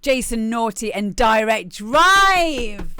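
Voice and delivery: announcer. Real, not acted. Announcing the next track name.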